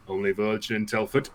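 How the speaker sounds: lightly put-on actor impression for dad's stock joke; Sean Bean. Dad remarking on a hot air balloon, light Sean Bean impression. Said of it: "do it in Sean Bean's voice".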